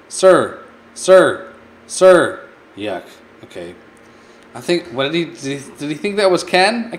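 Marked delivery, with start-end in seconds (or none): 0.1-2.5 calling out. Pretend. Calling after a leaving man.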